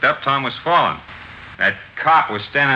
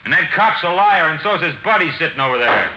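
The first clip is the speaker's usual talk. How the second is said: angry outburst; 6 semitones higher than usual. Acted. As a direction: blurt out the accusation hotly. Witness accuses police at inquest.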